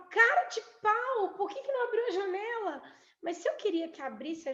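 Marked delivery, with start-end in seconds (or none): 0.1-2.8 mock angry complaint. Wife scolds partner about window.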